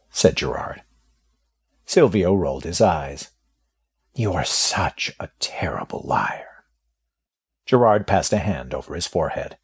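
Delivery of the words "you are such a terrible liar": breathy whispered retort; whispered accusation of lying. Acted. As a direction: hiss it under the breath as a doubting jab. Silvio retorts after "you look fine.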